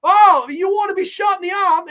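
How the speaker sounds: high, loud, indignant British retort; mock-furious British threat; bad British accent. Man snaps back at his girlfriend in a hammed-up British accent. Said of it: "I don't do a good British accent".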